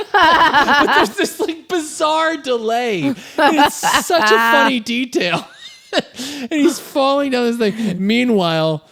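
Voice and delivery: high, slow words through laughter; giggling retelling; laughing. Real, not acted. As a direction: talk while cracking up. Laughing while telling.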